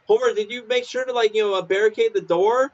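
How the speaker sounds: high, fretful wife voice; nagging cartoon mom; high worried wife checking the door. Wife voiced in retold scene.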